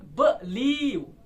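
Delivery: Believe split in two, light first syllable and strong, drawn-out second; believe with the stress on the second syllable; weak-strong stress. Shown as a demonstration. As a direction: stretch believe slowly, leaning on लीव. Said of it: "पहला वीक हो रहा है".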